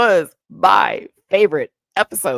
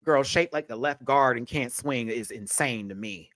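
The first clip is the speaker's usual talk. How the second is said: normal talking voice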